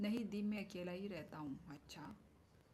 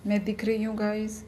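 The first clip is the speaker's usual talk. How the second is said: loud call to viewers; 18 dB louder than usual. Real, not acted. Streamer checks if viewers can see her, calling out loudly. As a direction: call out to the chat.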